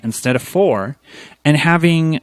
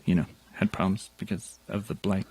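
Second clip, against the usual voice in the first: mumbled aside; 6 semitones lower, 10 dB quieter, faster than usual. Real, not acted. Trailing aside.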